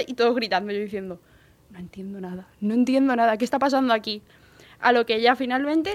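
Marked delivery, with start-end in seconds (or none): 1.7-2.5 hushed, much softer aside; bewildered whisper. Retold or imitated. Employee lost among screaming coworkers, bewildered whisper. 2.6-4.2 full-voiced, louder confusion; bewildered outburst. Employee baffled by everyone screaming, bewildered outburst.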